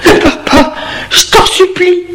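high, pleading mock sob; mock crying. Jeanne begging him to stay, lightly tearful. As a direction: beg him not to leave, tearfully. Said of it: "en pleurant".

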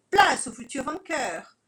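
pompous villain boast; haughty boast. Acted. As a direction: announce yourself grandly.

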